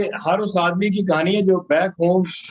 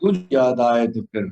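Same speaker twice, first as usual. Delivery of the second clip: solemn, measured poem delivery; stately nazm recitation; 4 semitones lower than usual. Acted.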